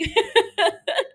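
high laugh at her own stumble; a real giggle over the slip; laugh at herself. Real, not acted. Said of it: "Why can't I speak?".

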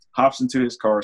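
ordinary delivery

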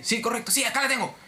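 high-pitched, insistent customer voice; high mock-belligerent retort; belligerent caller. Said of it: "seguía con esa actitud beligerante".

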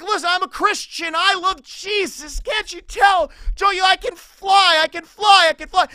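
high, loud, over-eager proclamation; hammed-up zealous shouting; excited boasting. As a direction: insist breathlessly that everyone notice.

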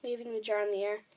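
plain reading voice

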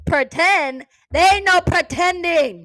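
high, loud incredulous retort; loud mocking disbelief; loud mock outrage. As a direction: throw the word back at him, scoffing. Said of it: "and I'm like".

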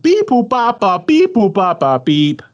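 alien voice. Pretend. Random pick sound before matchup.

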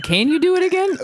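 high, eager plea; excited. Real, not acted. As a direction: beg for it again. Excited request.

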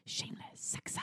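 hushed whisper of the slogan; whispered jingle. Shown as a demonstration. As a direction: breathe it out in a whisper. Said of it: "what do you wanna say on it?".

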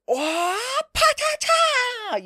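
squeaky high silly voice; cartoonish exclamation. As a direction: squeal it like a goofy anime hero. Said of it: "Itadori's like".

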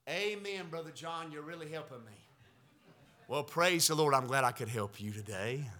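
hushed self-talk aside; quiet aside. Pretend. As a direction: drop to a soft private joke. Preacher answers himself softly.

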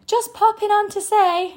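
high, singsong breezy imitation; mock casual. Coach mocking how women open their stories, high breezy mimicry. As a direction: mimic a throwaway greeting, pitched well up. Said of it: "You're making it sound like a hobby".

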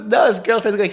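ordinary talk

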